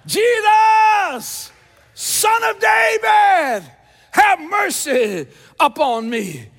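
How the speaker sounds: huge drawn-out shout, high then coming down; crying out. Acted. Blind Bartimaeus begging Jesus for mercy, full-throated slow cry. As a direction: holler slowly at the top of your lungs. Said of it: "I think I woke somebody up".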